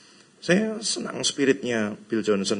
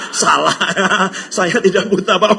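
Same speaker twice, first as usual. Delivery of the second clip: high, loud laughter breaking through the words; laughing retelling; 7 semitones higher, 8 dB louder than usual. Retold or imitated. Preacher tells the congregation about the guide, laughing hard. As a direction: laugh out loud while telling the story.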